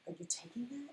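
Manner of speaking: high, soft mock disbelief; imitated hushed question. Daughter questioning mom on a walk, imitated hushed voice. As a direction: ask it small and hushed.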